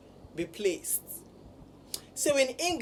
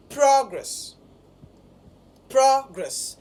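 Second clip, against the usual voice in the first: "progress" stressed on PRO, punched louder and higher; first-syllable stress; 4 semitones higher, 6 dB louder, slower than usual. Shown as a demonstration. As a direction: demonstrate "progress" with a strong PRO, then a falling second syllable. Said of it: "the stress is on the first syllable".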